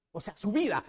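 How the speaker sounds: usual speaking voice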